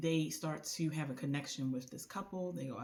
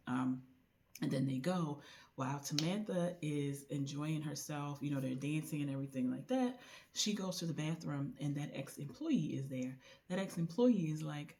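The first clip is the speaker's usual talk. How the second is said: ordinary talk, nothing marked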